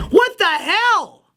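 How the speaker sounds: high, drawn-out what the hell; exasperated. Real, not acted. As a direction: stretch it out in disbelief. Exasperated reaction to word salad.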